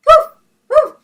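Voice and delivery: high, loud yappy woof; puppy barking. Acted. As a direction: yap like a noisy puppy. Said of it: "He barked at the tree".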